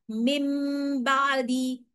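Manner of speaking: मिम बादी with a nasal m held before b; मिम बादी recited with ghunna; Quranic recitation. Shown as a demonstration. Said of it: "कैसे पढ़ना है?".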